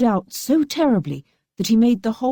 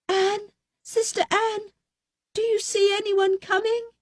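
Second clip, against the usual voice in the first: desperate crying out; 3 dB quieter than usual. A narrator's reading. Fatima crying up to her sister. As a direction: beg for any sign. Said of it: "again she cried".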